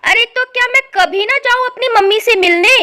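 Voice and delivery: loud, high outburst; indignant protest. Acted. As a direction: protest hotly to your husband. Wife quarrels with her husband.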